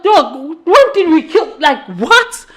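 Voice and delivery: laughing through a friend's baffled retort; laughing disbelief. Retold or imitated. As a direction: play the friend cracking up in disbelief. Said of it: "And the guys like".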